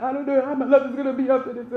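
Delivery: hammed-up tearful babble; mock crying. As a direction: imitate sobbing out your worries on a call. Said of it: "with him crying like".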